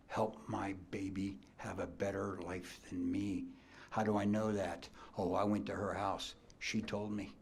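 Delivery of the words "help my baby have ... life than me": whispered prayer. Retold or imitated. Pregnant mother praying to the Lord.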